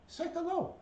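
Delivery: very high coaxing prompt; pet-talk voice. Real, not acted. Owner prompting a pet to greet, high pet-talk. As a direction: lift it way up high for the bird.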